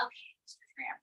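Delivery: hushed unvoiced aside; whisper. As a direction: breathe it without voice. Aside to the puppet.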